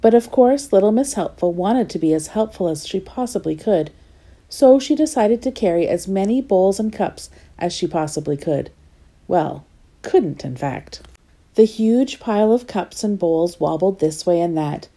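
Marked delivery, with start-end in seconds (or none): none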